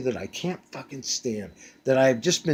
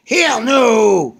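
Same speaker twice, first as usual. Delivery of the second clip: shouted refusal; 10 semitones higher, 11 dB louder than usual. Retold or imitated. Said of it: "the husband's like".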